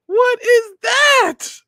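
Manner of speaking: very high, loud drawn-out squeal; shrieking disbelief. Acted. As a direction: squeal it out in slow mock horror. Mock reaction to the photo.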